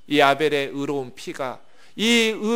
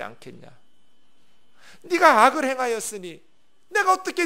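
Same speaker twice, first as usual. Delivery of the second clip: angry God; 8 semitones higher than usual. Acted. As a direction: yell at him like a furious father. Preacher voices God scolding Cain.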